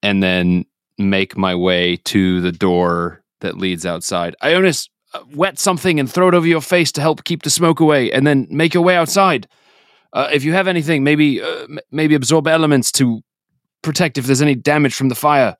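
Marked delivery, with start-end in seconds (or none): 4.4-15.5 higher-pitched, urgent in-character coaching; urgent character instructions. Acted. Filner coaches companion through the fire, urgent raised voice. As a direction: call out survival advice, pitch raised.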